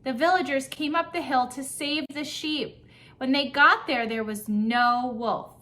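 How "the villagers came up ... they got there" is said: ordinary delivery, nothing marked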